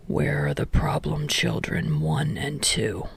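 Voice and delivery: low, soft tired teacher voice; Aizawa voice. A narrator's reading. Weary teacher calling roll, low and tired.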